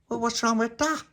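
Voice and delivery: very high-pitched comeback; indignant question. Acted. Little Pappy answering Pauline's jibe, indignant question. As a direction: ask it in high-voiced affront.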